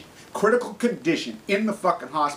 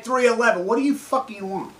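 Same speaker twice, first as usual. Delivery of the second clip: ordinary talk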